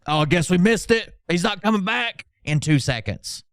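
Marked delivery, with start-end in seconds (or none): 0.1-2.3 whiny mock chatter, higher; a light sneer at chat users; lightly voiced whiny viewer.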